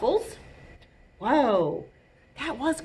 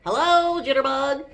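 high, louder hello; cheery butterfly greeting; 5 semitones higher, 4 dB louder than usual. A narrator's reading. Toast the butterfly answering Jitterbug, greeting brightly. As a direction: greet your friend in a raised character voice.

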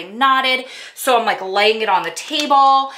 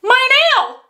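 high, loud cry over the lost nail; a sudden high shriek of dismay; dismayed yelp; 5 dB louder than usual. Retold or imitated. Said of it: "I looked at her and I go".

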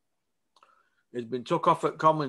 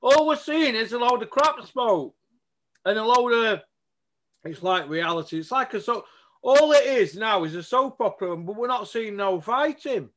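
worked-up rant; 6 semitones higher, 4 dB louder than usual. Real, not acted. Ranting about no fights.

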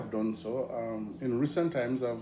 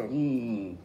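knowing hmm; 4 dB louder than usual. Retold or imitated. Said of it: "when you say like this".

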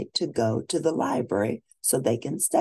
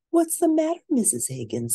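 lightly voiced girl, higher and eager; young princess voice; 10 semitones higher, 4 dB louder than usual. Sophia asking the librarian for books, eager girl voice. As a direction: ask like a keen young girl.

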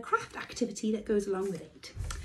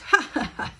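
teasing laugh; 8 semitones higher, 7 dB louder than usual. A narrator's reading. Gingerbread man laughing.

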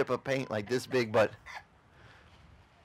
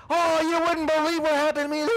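high, loud put-on voice telling a sob story; mock gossip; 7 dB louder than usual. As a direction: ham up the aggrieved storyteller. Guy retells his ding to friend.

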